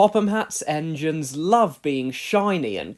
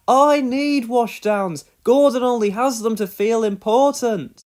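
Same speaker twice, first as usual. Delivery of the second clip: complaining; 6 semitones higher, 8 dB louder than usual. A narrator's reading. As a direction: insist he needs it too. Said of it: "he complained".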